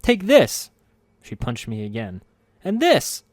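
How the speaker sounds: high, loud fierce shouts; battle cry. Acted. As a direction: yell with each punch. Punching while shouting.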